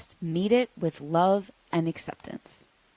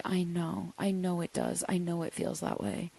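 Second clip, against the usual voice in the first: much softer, gentle soothing; soft reassurance; 9 dB quieter than usual. Shown as a demonstration. Answering her raging inner child, soft and soothing. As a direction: hold her hurt with quiet calm. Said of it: "you can just say".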